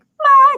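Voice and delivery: ma very high and loud, held. Shown as a demonstration. Teacher exaggerating the high tone for a student, very high. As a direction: squeak ma at the top and hold. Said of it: "You don't come down, like".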